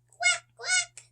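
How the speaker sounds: very high quacking; child quacking for the ducks. Acted. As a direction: quack like a little duck. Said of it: "says the ducks".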